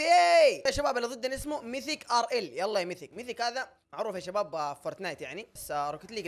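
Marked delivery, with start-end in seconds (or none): 0.0-2.6 high, loud game-start hype; excited shout. Real, not acted. Streamer hyping up teammate as first game starts, excited shout. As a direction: shout it out to kick off the match.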